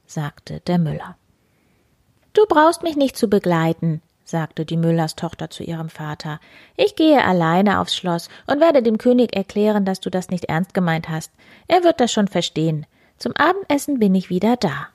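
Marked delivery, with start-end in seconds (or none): 2.3-4.0 higher, lightly voiced young daughter; confident miller's daughter voice; daughter's voice. Daughter reassures her father. 6.7-14.9 confident miller's daughter voice; daughter's voice. Acted. Daughter reassures her father.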